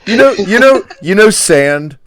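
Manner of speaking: high, loud, hammed-up mock voice; a squeaky, overdone send-up of a film director. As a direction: squeal it like a silly parody of the director. Mocking Lucas's sand direction.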